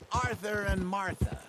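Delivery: usual speaking voice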